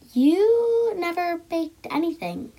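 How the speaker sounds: can't believe dad can bake; doubtful little girl. A narrator's reading.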